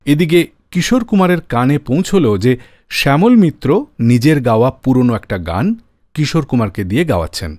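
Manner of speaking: ordinary talk, nothing marked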